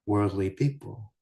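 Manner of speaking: ordinary talk, nothing marked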